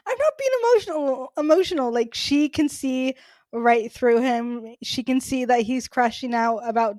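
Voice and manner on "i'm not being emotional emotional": high-pitched mock protest; squeaky-high imitated indignation, quickly said; mock indignant. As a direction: quote a defensive denial, voice leaping way up. Acting out the Doctor's defensive retort.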